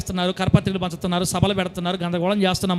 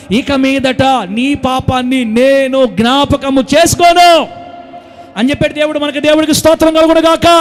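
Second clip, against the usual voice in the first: thunderous pulpit climax, louder and higher as it goes; full-force preaching; 4 semitones higher, 7 dB louder than usual. Real, not acted. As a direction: build God's promise to a roaring peak.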